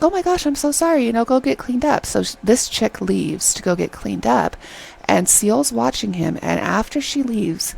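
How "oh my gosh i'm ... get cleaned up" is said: high-pitched mock apology; exaggerated apologizing that eases down in pitch; apologetic guy imitation. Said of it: "and Senior's like".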